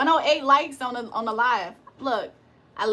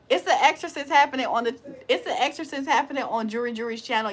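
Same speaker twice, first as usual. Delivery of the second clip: normal talking voice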